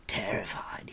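hushed confiding whisper. A narrator's reading. Bard confesses his fear to Taran in a whisper. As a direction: breathe it secretly to him. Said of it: "he whispered".

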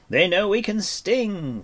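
high, loud, drawn-out battle cry; exultant cry. Acted. Bard cheers the archers' hit, crying out. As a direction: cry out slowly and triumphantly. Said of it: "Fluter cried".

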